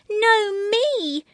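high, loud protesting little voice; squabbling child. A narrator's reading. Child squabbling with sibling over seat, high protest. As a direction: protest like a child losing a turn.

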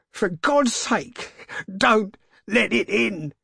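slow, high, terrified plea; trembling cry. A narrator's reading. Old man begging his wife not to open up, trembling cry. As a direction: plead loudly, trembling with fear. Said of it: "cried the old man, trembling".

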